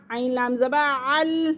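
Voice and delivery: ordinary talk, nothing marked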